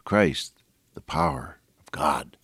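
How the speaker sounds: hushed whisper of reverence; preacher whispering the scripture's climax; whispered awe. Acted. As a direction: breathe it out like a secret of faith.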